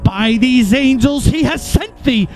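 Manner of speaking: ordinary delivery, nothing marked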